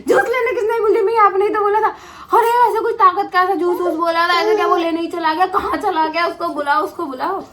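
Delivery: mock outrage with a laugh; indignant retort. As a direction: throw the doctor's words back at him. Retorting to the doctor, amused.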